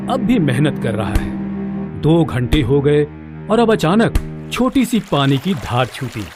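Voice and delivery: plain reading voice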